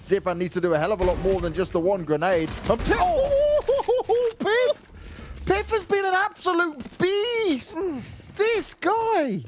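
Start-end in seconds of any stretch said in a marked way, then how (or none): 2.7-9.4 high, drawn-out caster scream; excited hype. Real, not acted. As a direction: lose it over the play. Caster hypes a big play.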